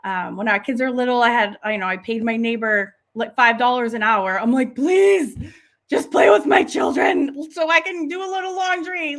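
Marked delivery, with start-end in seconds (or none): 4.8-9.2 loud, high mock pleading; retold desperate plea. Mum begging neighbour to watch the kids, mock-desperate. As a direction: beg in playful desperation, louder and higher.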